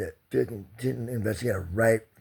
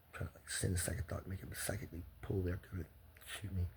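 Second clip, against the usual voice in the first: softer, breathy whisper; 11 dB quieter than usual. Real, not acted. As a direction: whisper it like a dark secret. Fantasizing in hushed tones.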